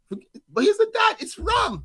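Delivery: very high, slow excited shout. Retold or imitated. Dad retelling his thrill at his kid's wrong answer. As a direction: burst out thrilled at the mistake. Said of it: "And I'm saying".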